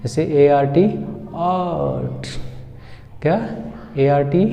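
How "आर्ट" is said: आर्ट as a long "aaat"; higher, drawn-out आर्ट, r left silent; stretched long vowel. Shown as a demonstration. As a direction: hold the opening vowel of आर्ट. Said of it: "थोड़ा सा ज़्यादा खींचेगा".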